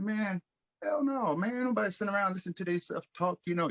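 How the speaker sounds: high, fast brush-off, hell no; dismissive retort. Retold or imitated. To Steve about his self-talk, scoffing high and fast.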